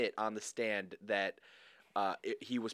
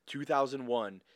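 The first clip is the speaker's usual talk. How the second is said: ordinary delivery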